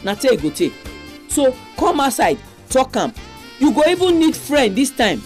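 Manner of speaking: normal talking voice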